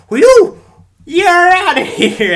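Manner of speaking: high, loud, slow "you're outta here"; hammed-up umpire yell, stretched out; umpire ejection call. Umpire tossing a man walking away, hammed-up yell. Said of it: "and then he's just like".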